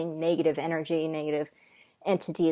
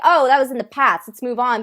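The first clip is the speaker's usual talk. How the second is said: dismissive; 5 semitones higher, 9 dB louder than usual. Retold or imitated. People dismissing Native trauma.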